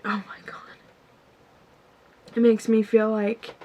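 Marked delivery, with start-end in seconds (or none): 0.0-0.6 whispered under her breath, nearly silent; real hushed whisper fighting back tears; breathless whisper. Real, not acted. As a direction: let it slip out as a soft, overwhelmed whisper. Overwhelmed while tearing up on camera.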